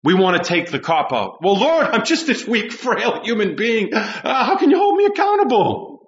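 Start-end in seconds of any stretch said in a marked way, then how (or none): 1.4-5.9 higher, pleading mock excuse-maker; whining excuse. Preacher mimicking someone who wants to cop out, whining. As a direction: build to a high, whiny protest.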